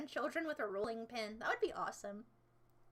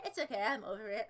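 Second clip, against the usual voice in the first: ordinary talk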